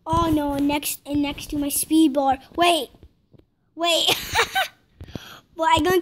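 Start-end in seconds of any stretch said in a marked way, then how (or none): none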